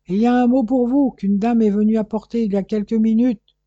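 loud and high, calling up the stairwell; concierge calling out. A narrator's reading. Concierge calls out to Corfe about a note, loud and high. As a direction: shout it up to the tenant from below. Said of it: "lui cria la concierge".

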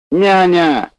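high, loud drawn-out yell; calling for the nurse. Acted. Bored patient yells again for the nurse, loud call.

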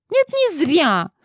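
put-on very high, slow, stamping girl; petulant stomp. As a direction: stamp your foot and insist. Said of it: "топнула она ножкой".

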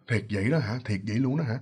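put-on low, hushed older man's questioning; acted pondering uncle. Uncle Bảy scratches his head at the news, acted low pondering. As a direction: drop deep and soft, as if thinking it over.

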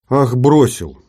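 a lightly voiced indignant young woman; girl's voice. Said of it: "включилась Жанна". (возмущённый девичий голос)

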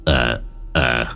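low, soft, halting mumble; hesitant low old uncle; dazed old man. Acted. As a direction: stammer doubtfully. Stunned uncle hesitantly tests her.

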